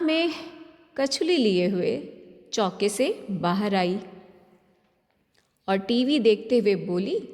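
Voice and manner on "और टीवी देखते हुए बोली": ordinary delivery, nothing marked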